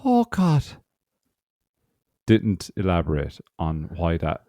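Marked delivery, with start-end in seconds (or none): 0.0-0.6 put-on high, weary old woman; old lady voice. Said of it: "I'm gonna do an old lady voice".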